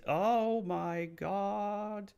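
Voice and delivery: high, drawn-out mock awe; awestruck exclamation. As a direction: stretch every word out, high and reverent. Awed retelling of Warwick activating.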